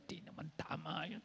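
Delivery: devil's sly whisper; hushed tempting whisper. Pretend. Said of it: "Bubulungan ka niyan".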